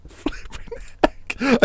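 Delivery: slow, whispered disbelief; stunned whisper at a near miss; breathless whisper. Real, not acted. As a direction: breathe it out in shock. Tense finish of match.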